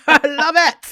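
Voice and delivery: high, loud, gleeful cheer; delighted shout. Real, not acted. Co-host applauding her friend's advice, delighted shout. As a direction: cheer your friend on at full volume. Whooping praise of co-host's advice.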